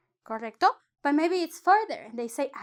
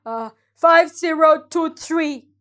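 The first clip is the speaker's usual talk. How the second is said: a hint of a raffle host calling the winner; prize announcer; 5 dB louder than usual. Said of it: "the person in the scenario is like".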